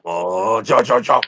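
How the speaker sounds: low, mock cartoon villain voice; lightly voiced cartoon villain, low and teasing; Mojo Jojo impression. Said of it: "you can do Mojo Jojo all you want".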